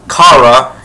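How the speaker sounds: Kara said KAH-ruh, with an open "ah"; KAR-uh pronunciation. Shown as a demonstration. Fan confirms how Supergirl's name is pronounced, Kara as KAH-ruh. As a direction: say Kara as "KAR-uh", rhyming with "car-a". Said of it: "yes, it's pronounced Kara".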